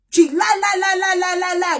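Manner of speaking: loud, high repeated lie, each one hammered; shouting lie over and over. Real, not acted. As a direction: fling the word lie out again and again in outrage. Angry rant about lying woman.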